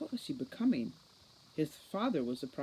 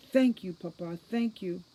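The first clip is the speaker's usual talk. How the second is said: loud joyful thanks; gushing gratitude; overjoyed; 3 semitones higher, 6 dB louder than usual. Acted. Overjoyed mother thanks son.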